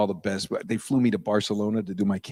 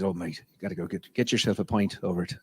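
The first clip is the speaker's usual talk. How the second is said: put-on Irish accent; 3 semitones lower, 4 dB quieter than usual. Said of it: "He says".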